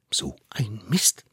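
slow whispered frustration; whispered curse. Acted. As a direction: hiss it under your breath. Thought aloud.

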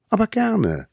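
high, eager little voice; tiny eager hunter. Acted. Little hunter brings food to the mammoth, high and eager. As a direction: play the smallest one, proud and keen. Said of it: "sagte der Allerkleinste".

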